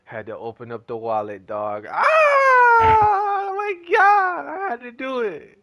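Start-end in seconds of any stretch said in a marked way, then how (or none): 1.9-5.6 high, loud cry of pain over the spend; anguished shout. Real, not acted. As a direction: wail it out like it physically hurts. Agonized over spending money.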